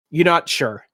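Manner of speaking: ordinary delivery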